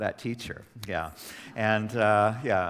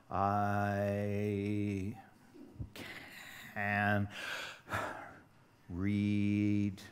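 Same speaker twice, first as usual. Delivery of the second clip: slow reader; 3 semitones lower, 5 dB quieter, much slower than usual. Retold or imitated. Imitates a slow struggling reader.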